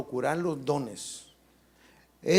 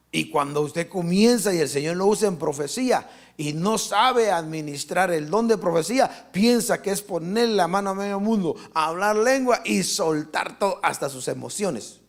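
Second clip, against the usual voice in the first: preaching at full force; 3 semitones higher, 5 dB louder than usual. Acted. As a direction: rouse the congregation, pushing hard. Preacher pushing again.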